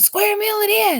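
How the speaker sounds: high, loud shout. A narrator's reading. Humbug shouting his verdict at the banquet. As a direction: bellow it out proudly. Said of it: "shouted the humbug again".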